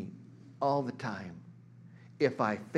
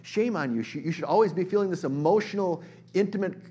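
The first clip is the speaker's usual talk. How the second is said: higher, louder scolding tone; mock scolding; 7 semitones higher, 6 dB louder than usual. Preacher voicing a shaming critic, mock scold. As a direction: play the scold you don't mean. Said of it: "I don't say that to say".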